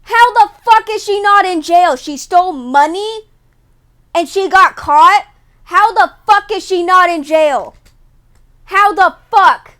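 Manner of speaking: loud, high, incredulous shouting; outraged disbelief. Real, not acted. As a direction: yell it like you can't believe it. Outraged at thief not jailed.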